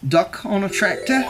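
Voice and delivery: ordinary delivery, nothing marked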